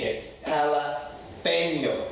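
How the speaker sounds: slow "jalapeño" with a nyo sound; "jalapeño" drawn out, Spanish ñ. Shown as a demonstration. Teacher repeating for students, slow Spanish pronunciation. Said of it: "It's not hard to say".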